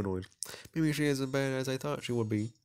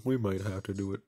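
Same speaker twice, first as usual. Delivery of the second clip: ordinary talk, nothing marked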